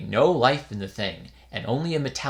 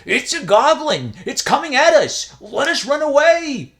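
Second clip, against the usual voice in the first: high, loud panicked scream; terrified scream; 14 semitones higher, 10 dB louder than usual. A narrator's reading. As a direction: scream and flee in terror. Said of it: "he also was terrified ... and screamed".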